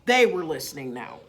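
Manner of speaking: plain reading voice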